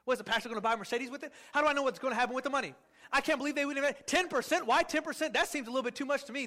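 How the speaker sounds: fast, suspicious complaining; indignant rush. Retold or imitated. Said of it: "some of you emotionally said".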